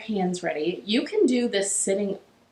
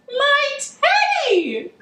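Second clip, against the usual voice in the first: very high, drawn-out cry; boy's cry; 4 dB louder, much slower than usual. A narrator's reading. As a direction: cry out slowly at the top of your pitch. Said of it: "cried Eddie".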